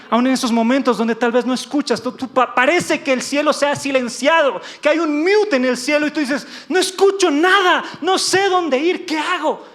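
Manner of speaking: sermón que sube a grito angustiado; prédica intensa. Acted. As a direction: predica con fuerza creciente, más agudo y fuerte hacia el final. Preaches at full force, building. (prédica apasionada en español)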